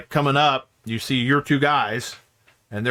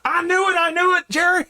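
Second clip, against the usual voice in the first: excited outburst. Acted. Guard realizing Connor is behind it, high excited. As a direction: burst out in triumph.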